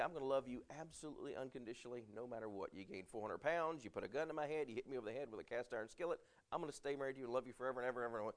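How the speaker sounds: fast, tumbling run of promises; rattled-off vow. Retold or imitated. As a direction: race through it like an over-eager newlywed. Mocking extravagant unconditional vow promises.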